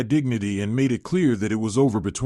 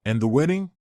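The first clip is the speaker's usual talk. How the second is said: usual speaking voice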